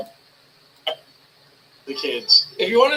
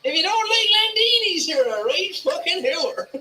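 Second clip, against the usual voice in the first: put-on quoting the kid in a broad rural Irish Midlands accent, pitched higher; rural Irish farmer accent; 11 semitones higher than usual. Streamer mimicking the Irish farm kid's rant, broad rural Irish accent. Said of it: "he sounds exactly like I did when I was a kid".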